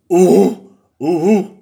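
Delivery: loud hooting "oo-hoo"; owl's loud alarm call. Acted. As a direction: hoot like a startled owl. Owl hoots in alarm.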